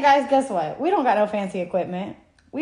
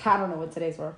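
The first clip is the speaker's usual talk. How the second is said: normal talking voice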